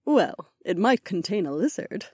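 smirking. A narrator's reading. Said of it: "said with a smirk".

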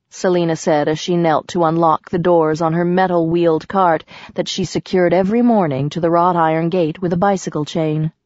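ordinary delivery, nothing marked

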